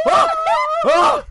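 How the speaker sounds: loud, high whoa; surprised shout. Real, not acted. Friend hearing the Twitter handle trick, surprised. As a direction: react to the shocking story. Reacting to the story.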